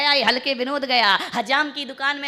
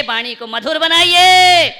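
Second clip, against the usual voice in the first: high, loud, slow appeal; preacher's climactic call; rousing exhortation; 5 semitones higher, 13 dB louder, slower than usual. Real, not acted. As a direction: stretch it out to the whole hall.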